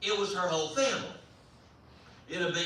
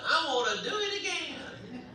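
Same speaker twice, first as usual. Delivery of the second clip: little brother's voice; 10 semitones higher than usual. Retold or imitated. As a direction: imitate a small boy in a high squeal. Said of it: "my little brother Joe said".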